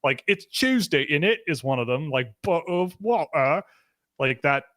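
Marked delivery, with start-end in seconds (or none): 0.3-1.4 mock British accent, pitched up; lightly hammed-up British accent. Shown as a demonstration. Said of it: "ideas of what a British accent sounds like". 2.4-3.6 mock British accent, pitched up; exaggerated British accent, tossed off as an example. Shown as a demonstration. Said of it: "ideas of what a British accent sounds like".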